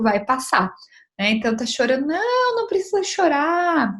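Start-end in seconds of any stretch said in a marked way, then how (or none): 2.1-3.9 higher, brushing-off mock parent voice; dismissive parent. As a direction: wave the tears away, pitched up. Pai dispensa o choro da criança. (mãe imitada dispensando o choro, em tom agudo)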